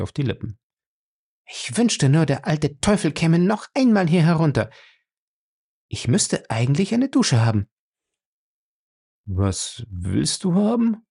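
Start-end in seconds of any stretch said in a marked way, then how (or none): 1.5-7.7 high, wishful female voice; spirited woman, pitched up; plucky captive woman. A narrator's reading. 9.3-11.1 slow, disbelieving question; slowly puzzled, can't believe it; incredulous. A narrator's reading. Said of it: "fragte er ungläubig".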